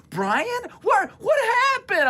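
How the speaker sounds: put-on very high alarmed girl's voice; a big sister finding her soaked brother; worried sister. Sister Kelly asking what happened, high alarmed voice.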